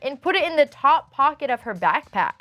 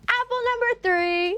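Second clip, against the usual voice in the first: announcer call; 11 semitones higher than usual. Acted. Announcing next story, host style.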